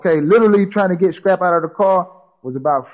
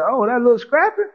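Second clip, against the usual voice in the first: higher-pitched retold police officer; voicing the cop; 9 semitones higher than usual. Police officer at the crash scene, imitated higher. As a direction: play the officer recognizing the kid.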